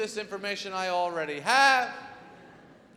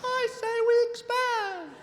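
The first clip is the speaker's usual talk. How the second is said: absurdly high, drawn-out squeak; high-pitched businessman; 13 semitones higher, slower than usual. Shown as a demonstration. Man talking business to other men, absurdly high-pitched. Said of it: "You can't make any money talking like this".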